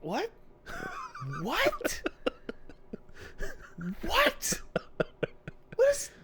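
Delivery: stunned, half laughing; real incredulous squeak; laughing disbelief. Real, not acted. Stunned by a crude joke.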